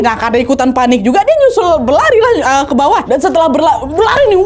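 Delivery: ordinary talk, nothing marked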